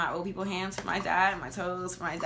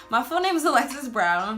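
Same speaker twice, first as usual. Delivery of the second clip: louder and higher, firmly restated; emphatic repeat; 6 semitones higher, 6 dB louder than usual. Shown as a demonstration. After refusing her middle name, restates full name emphatically. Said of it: "Do I feel like giving you my middle name".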